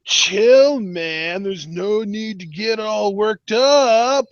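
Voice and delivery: a hammed-up stoner drawl, slow; lazy laid-back surfer voice; mock R V D. Co-host mocks R V D's fake chill, slow and laid-back. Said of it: "when R V D does it".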